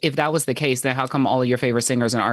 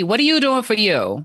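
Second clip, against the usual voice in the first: ordinary talk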